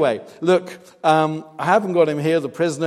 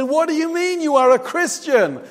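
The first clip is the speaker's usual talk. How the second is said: high, loud retold outrage; indignant challenge; 12 semitones higher, 6 dB louder than usual. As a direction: challenge her in incredulous, re-enacted indignation. Acts out his quarrel with woman.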